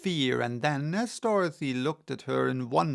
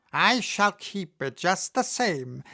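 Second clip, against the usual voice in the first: higher witch voice with a mocking laugh; 5 semitones higher, 3 dB louder than usual. A narrator's reading. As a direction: taunt her, laughing. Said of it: "laughing at her".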